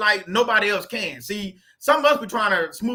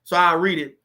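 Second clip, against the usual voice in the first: usual speaking voice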